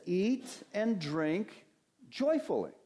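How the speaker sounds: slow "Eat and drink", then "joyfully." lifted high; stress on joyfully. Shown as a demonstration. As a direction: pastor stressing the manner of the command to his congregation, joyfully lifted high. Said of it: "the emphasis here of the command".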